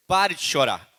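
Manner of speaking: loud, firm title line; preacher's loud command; emphatic command. Shown as a demonstration. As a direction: announce it like a command. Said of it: "Essa é a mensagem".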